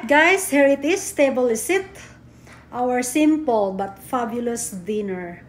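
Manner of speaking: ordinary talk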